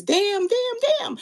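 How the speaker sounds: high-pitched, hammed-up sitcom catchphrase; Florida Evans. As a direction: cry it out high, each word its own beat. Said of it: "In my Florida Evans voice".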